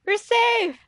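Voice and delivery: loud high relief; relieved cheer. Real, not acted. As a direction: burst out with relief. Escaped the chase.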